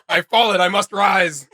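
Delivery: mock heroic Pantheon. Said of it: "He's like".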